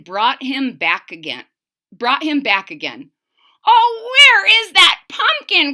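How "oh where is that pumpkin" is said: put-on high, loud croaky old man's wail; croaking old man. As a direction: croak it out, desperate for the pumpkin. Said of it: "croaked the old man".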